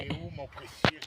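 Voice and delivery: soft creepy Gollum voice. Pretend. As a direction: hiss it like Gollum, softly. Gollum line, precious.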